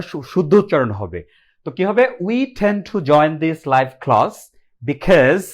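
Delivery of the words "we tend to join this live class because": slow, deliberate pronunciation model; slow careful demo reading. Shown as a demonstration. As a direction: spell out each word for the class. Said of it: "তখন আমার উচ্চারণটা খেয়াল করবেন".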